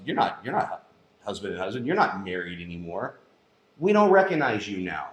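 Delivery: hushed, cold official verdict; cold government voice. Retold or imitated. Government telling a married couple, hushed and cold. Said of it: "the government tells you".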